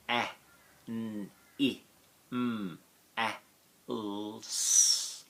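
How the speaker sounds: A N I M A L S said as letter sounds one by one; phonetic spelling. Shown as a demonstration. Teacher sounding out animals letter by letter for the class. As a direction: sound out each phonogram of animals. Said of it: "Let's spell it phonetically".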